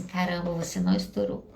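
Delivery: low, hushed note to self; quiet self-congratulation. Retold or imitated. Speaker congratulating herself for staying calm, quietly proud. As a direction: drop low and soft, privately impressed.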